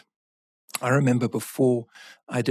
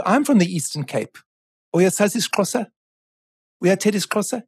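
excited recognition; 8 semitones higher, 6 dB louder, faster than usual. Retold or imitated. Excited discovery of shared home region.

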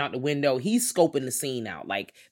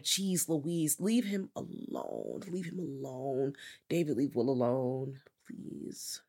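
soft, drawn-out exasperated begging; weary plea; 8 dB quieter, much slower than usual. Retold or imitated. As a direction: plead quietly, fading out on the last word. Weary plea on the character's behalf.

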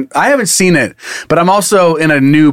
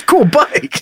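laughing imitation; 10 semitones higher than usual. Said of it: "repeating imitation laughing".

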